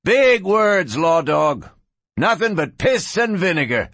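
high, punchy gunslinger sneer; scornful taunting. A narrator's reading. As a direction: insolent high-pitched Western jeer. Gunslinger scorns lawman's demand to surrender.

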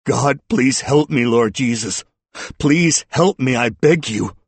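high, loud desperate prayer; anguished begging. A narrator's reading. Sobbing man praying to God, anguished begging. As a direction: cry out for help in anguish. Sobbing suspect prays for help.

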